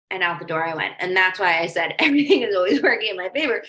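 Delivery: normal talking voice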